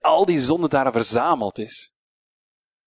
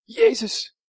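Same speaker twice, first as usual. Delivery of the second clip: name called out very high; Mary running to the disciples, calling out very high; urgent call. Retold or imitated. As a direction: cry the name in alarm. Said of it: "En ze zegt".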